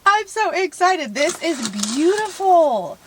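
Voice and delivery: gushing. Real, not acted. Gushing over gift.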